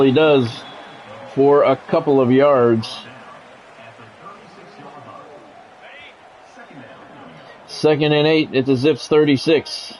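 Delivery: measured broadcast call of the down and the drive; sports play-by-play. Acted. Streamer calling his video game to chat, play-by-play announcer voice.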